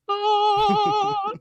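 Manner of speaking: awed oh. Retold or imitated. Reacting to the superhero landing.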